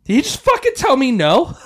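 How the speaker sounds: shocked he refused, voice jumps up; roommate's friend said no, stunned and loud; outraged disbelief. Retold or imitated.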